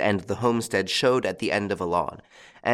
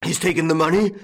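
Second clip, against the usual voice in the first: high, loud, incredulous bellow rising at the end; bellowed question; 7 semitones higher, 5 dB louder, faster than usual. A narrator's reading. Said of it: "bellowed old Goussot".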